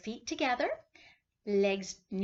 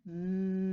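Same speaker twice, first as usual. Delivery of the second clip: bee hum; 3 dB louder than usual. Shown as a demonstration. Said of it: "Do I sound like a bee?".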